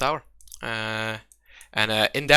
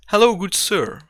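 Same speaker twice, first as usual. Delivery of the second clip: higher, courteous host voice after a voice change; mannered, toned-down innkeeper; changed bartender voice; 5 semitones higher, 5 dB louder than usual. Acted. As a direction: welcome the guest with exaggerated politeness. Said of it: "I can change that".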